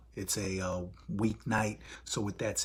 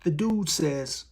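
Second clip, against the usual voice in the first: ordinary delivery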